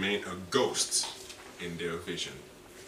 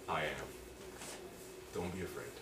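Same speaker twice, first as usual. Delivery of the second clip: soft, lower and gentle; tender reassurance; 7 semitones lower, 15 dB quieter than usual. Acted. Preacher voicing God's soft comfort to the church. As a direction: comfort them quietly.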